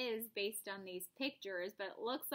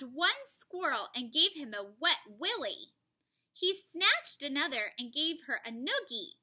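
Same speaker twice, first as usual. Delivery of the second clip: louder animated read-aloud; lively story narration; 3 semitones higher, 6 dB louder than usual. A narrator's reading. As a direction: read it with big storybook energy.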